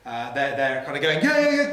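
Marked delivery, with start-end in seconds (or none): 1.2-1.7 nervous agreement. Acted. Voicing Helen and Jerry nervously.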